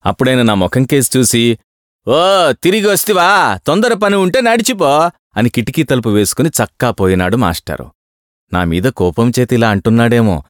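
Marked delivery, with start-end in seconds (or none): 2.1-5.1 higher, mocking clerk voice; sarcastic clerk. A narrator's reading. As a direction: sneer at him and shut the window. Clerk snubs returning traveller.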